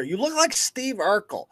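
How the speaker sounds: ordinary talk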